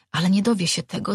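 usual speaking voice

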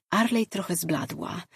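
plain reading voice

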